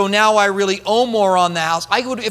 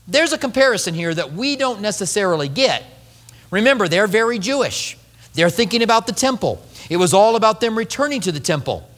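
ordinary delivery

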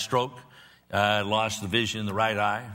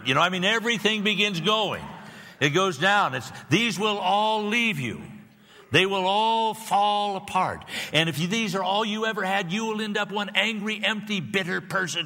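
louder and higher, building sermon fervor; full-force preaching; 10 semitones higher, 4 dB louder than usual. Acted. Pastor warning the aging congregation, in full-force preaching. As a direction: preach it hard, voice lifted, slowing on the warning.

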